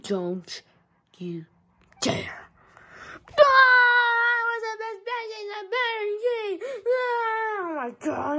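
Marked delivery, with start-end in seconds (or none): 3.3-8.4 high, sing-song sobbing wail; wailing cry. Acted. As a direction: wail it out dramatically like a crybaby. Character wailing after fall.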